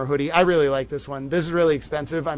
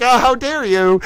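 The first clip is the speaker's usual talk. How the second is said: mock outrage; 6 semitones higher, 4 dB louder than usual. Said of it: "angry lawyers waving around my writing being like".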